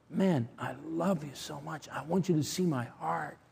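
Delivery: soft, tender confession; hushed, heartfelt admission of love; vulnerable. Retold or imitated. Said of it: "That was vulnerability".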